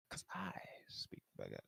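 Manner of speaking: whispering. Pretend. Whispered aside.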